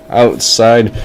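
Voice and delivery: usual speaking voice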